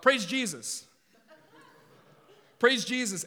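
mock shout of praise, loud and high; imitating a yell. Preacher mimicking a gym player yelling after shots, mock shout. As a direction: belt it out like the guy at open gym. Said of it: "he would yell out".

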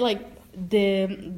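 ordinary talk

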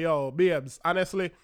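imitating a Jamaican patois voice, rising to a loud protest at the end; put-on Jamaican patois pleading with his date; light Jamaican patois complaint. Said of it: "Me should have put on my foot and say".

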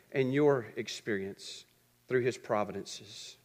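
low, soft and measured, with long pauses; hushed, solemn preaching. Real, not acted. Preacher telling the congregation what God has done, hushed and solemn. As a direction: bring the sermon right down, weighing each phrase.

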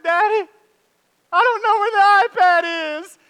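high, loud whining child in mock tears; imitating tearful panic. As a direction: play the daughter near tears and panicking. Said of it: "she's, like, at tears".

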